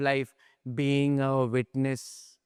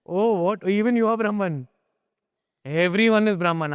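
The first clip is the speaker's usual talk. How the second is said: raised, louder show-off voice; mock smug student; 6 semitones higher, 4 dB louder than usual. Teacher imitating a boastful student, mock smug. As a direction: ham up the know-it-all.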